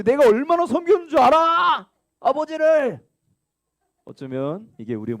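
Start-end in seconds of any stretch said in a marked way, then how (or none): 0.0-3.0 resentful retort. Acted. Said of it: "자기 이유로 가득해".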